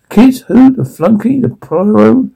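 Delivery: high incredulous sneer; mock each one in disbelief; scoffing disbelief. Mocking the idea of kids.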